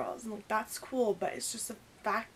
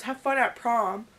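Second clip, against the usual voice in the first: snide jab; 9 dB louder, slower than usual. Retold or imitated. Patrick's parting jab.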